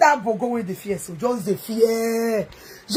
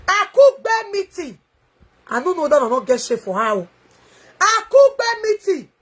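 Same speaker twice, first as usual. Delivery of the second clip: mocking imitation; 5 semitones higher, 3 dB louder than usual. Mocking Peter Ituna's words.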